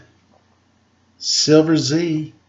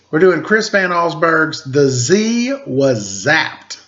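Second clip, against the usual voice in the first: ordinary delivery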